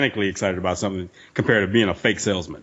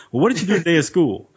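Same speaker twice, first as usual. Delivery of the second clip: higher-pitched interested parent; parent to kids; 7 semitones higher than usual. Acted. Mother greeting kids home from school, interested parent voice. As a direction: lift it as if asking a small child. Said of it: "if she came home and the kids".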